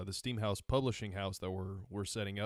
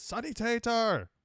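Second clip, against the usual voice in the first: mock German battlefield call, slow and high; German soldiers' shout; 13 semitones higher, 5 dB louder, slower than usual. Imitating game soldiers calling Sonny Tater, in a mock accent. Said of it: "all you hear is".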